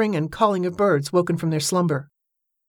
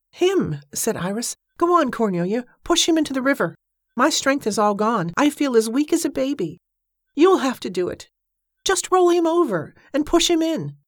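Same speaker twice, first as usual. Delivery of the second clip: urgent pleading; 8 semitones higher than usual. A narrator's reading. Urging her to push.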